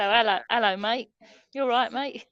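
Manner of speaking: loud, lively London "hello, hello, mate"; London greeting. Shown as a demonstration. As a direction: call out a cheery London hello. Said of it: "We say".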